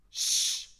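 a sharp, loud shh; loud shush. Acted. Crowd shushing the beggar, loud shh. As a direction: shush him hard. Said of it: "the crowd ... are saying".

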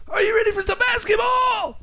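high shouted sportscaster call; Dick Vitale impression. Host impersonating a basketball announcer with a lost voice, shouting. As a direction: yell it like a hyped basketball announcer. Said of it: "I can do an impersonation".

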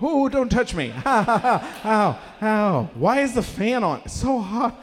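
high, pained mock yelping; hammed-up sore, overheated whining; sunburn agony. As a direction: wince as if every touch burns. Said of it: "the full body sunburn".

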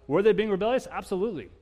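ordinary delivery

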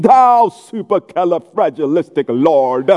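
slow, pompous mock invocation; hammed-up lofty praying; mock prayer voice. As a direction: parody a grandiose pray-er, drawing the words out. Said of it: "like, a prayer voice".

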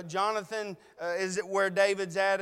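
ordinary talk, nothing marked

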